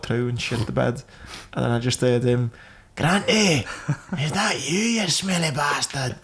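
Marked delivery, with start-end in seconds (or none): 3.0-6.2 high, slow jeering voice; mocking mate's voice. Mate in the next bed teasing him about the smell, slow and high. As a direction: call out across the ward, teasing.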